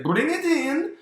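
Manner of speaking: very high, loud squeaky order; cartoon boss baby. CEO Baby orders the package inside, high squeaky command.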